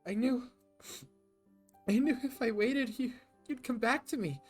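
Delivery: high, loud, tearful reproach; Anna sobbing. Acted. Anna sobbing to Jack that he left her, high and tearful. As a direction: cry out the hurt between sobs. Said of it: "her sobs make it hard for her to speak".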